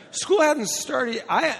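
real voice crack. Real, not acted. Speaker telling his story of moving to Michigan, voice cracking. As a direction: voice cracking up high like puberty. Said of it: "going through puberty with my voice".

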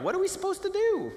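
high mock-bewildered question; confused churchgoer. Pastor mocks churchgoers confused by a solo. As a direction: play the lost congregant. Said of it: "people are like".

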